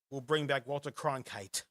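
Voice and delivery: usual speaking voice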